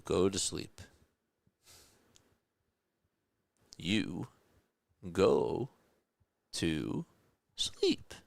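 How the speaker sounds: lightly voiced gentle monster coaxing a child to sleep, spaced words; a hint of a big monster coaxing Boo to bed; monster lulling a child. Recalling Monsters, Inc as the monster tells Boo to sleep, slow coaxing. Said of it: "the scene from Monsters, Inc ... say to Boo".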